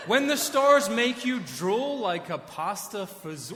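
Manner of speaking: high and loud, half laughing through the lyric; laughing quote. Retold or imitated. Pastor quoting a silly lyric to the congregation, laughing. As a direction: read the line as if you can't believe it.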